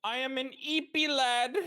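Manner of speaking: put-on high, babyish eepy voice; cutesy sleepy voice. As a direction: whine it like a sleepy kid. Playing up sleepiness.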